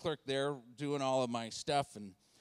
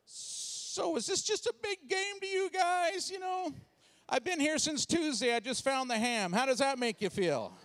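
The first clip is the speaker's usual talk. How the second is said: pretend ham voice; 8 semitones higher than usual. Comedian voices the ham on the belt, high squeaky. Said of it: "this is what she said".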